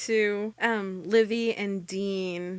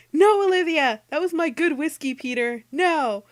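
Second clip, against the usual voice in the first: loud, high, outraged protest; scandalized; 8 semitones higher, 7 dB louder than usual. Retold or imitated. Dean protesting as Peter dumps his whiskey, scandalized. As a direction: wail over the ruined whiskey. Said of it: "Dean is scandalized".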